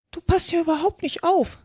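high-pitched, scolding mouse voice; stern mouse. A narrator's reading. Mouse reprimands inattentive Alice, sternly. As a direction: scold sharply in a small high voice. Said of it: "sagte die Maus strenge zu Alice".

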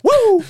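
high shouted woo; loud cheer. Real, not acted. Celebratory cheer.

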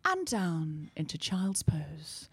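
slow, hushed, breathy-soft yoga instructor; soft yoga teacher voice. Retold or imitated. Mocking calm yoga teachers in Camden, softly slow. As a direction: drift through the poses in a soothing whisper. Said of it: "everyone talks like this".

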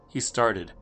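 ordinary delivery

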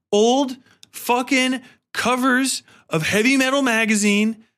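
ordinary delivery

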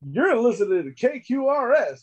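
high mock DJ station call; radio DJ voice. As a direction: announce it like an oldies jock. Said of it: "sixties radio D J".

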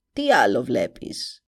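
put-on low, grim old witch. A narrator's reading. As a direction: croak it like a sinister witch. (φωνή μάγισσας)

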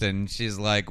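usual speaking voice

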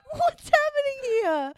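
high, loud, slow laughing cry; laughing shout. Real, not acted. Ranting to her co-host, laughing and shouting. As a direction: yell it out mid-laugh in disbelief. Cracking up at the absurdity.

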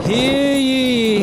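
high loud proclamation; town crier. Acted. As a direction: announce it to the square. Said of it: "town crier".

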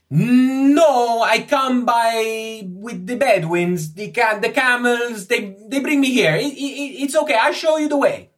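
put-on high, loud accented character voice; flustered, loud foreign scholar; excitable foreign Egyptologist, pleading. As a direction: insist eagerly in a high foreign voice. Ippolito explains about camels.

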